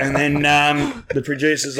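ordinary talk, nothing marked

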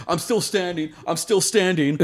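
high, stubborn mock boast; staggering fighter mocked, pitched high; mock dazed boaster. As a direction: play a knocked-out guy insisting he's fine. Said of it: "They're like".

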